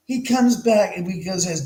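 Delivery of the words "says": normal talking voice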